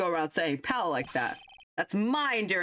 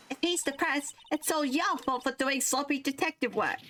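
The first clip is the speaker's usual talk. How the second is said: put-on much higher, bright and chirpy young girl; cheerful girl voice; 10 semitones higher than usual. Girl sidekick charging fifty cents apiece, high and chirpy.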